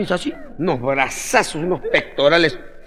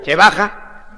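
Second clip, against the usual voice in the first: usual speaking voice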